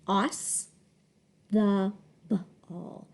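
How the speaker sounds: soft, halting "Toss the ball", sound by sound; low, careful decoding of "Toss the ball". Shown as a demonstration. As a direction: split "Toss the ball" into separate chunks. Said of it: "So it doesn't say toss the ball".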